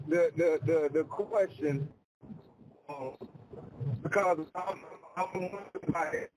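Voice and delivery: choppy phone line cutting out; bad connection, words dropping out; radio breaking up. Real, not acted. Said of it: "Sound like you're breaking up".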